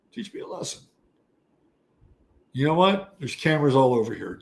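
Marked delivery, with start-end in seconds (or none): 0.1-0.8 whispered, dropped to a hiss; mock-taunting whisper. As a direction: breathe the dare without voice.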